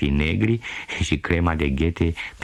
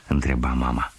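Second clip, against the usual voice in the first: ordinary delivery, nothing marked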